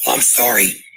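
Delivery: usual speaking voice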